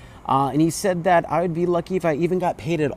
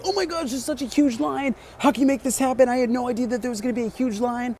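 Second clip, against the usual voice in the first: much higher, frantic mock voice; a high-pitched whiny impression of the agent losing his cool; mocking panicked guy; 11 semitones higher than usual. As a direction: squeal it like a flustered official. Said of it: "starts freaking out at me".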